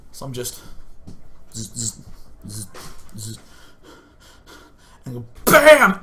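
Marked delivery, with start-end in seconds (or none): 1.5-3.4 soft, low insect bursts; quiet little buzzes; wasp buzz. Retold or imitated. Wasp waiting on the wall, wasp buzz. 5.5-6.0 explosive high, loud bam; sudden punch cry. Retold or imitated. Gamer punching at the wasp, sudden punch cry.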